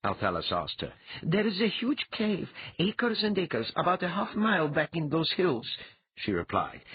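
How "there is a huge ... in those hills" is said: high-pitched woman's character voice; mind-reader giving a report. Acted. She answers Althalas about the enemy cave, reporting. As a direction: report what you sense, pitched up high.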